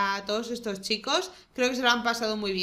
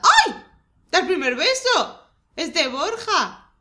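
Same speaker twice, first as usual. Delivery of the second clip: high, loud delighted cries; excited shriek; 7 semitones higher, 7 dB louder than usual. Real, not acted. Gamer shrieking as the Sims first kiss.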